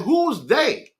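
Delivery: high, pointed baffled question. Retold or imitated. Asking Dave who keeps calling him, baffled. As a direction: demand to know, baffled.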